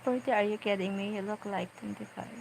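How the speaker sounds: ordinary talk, nothing marked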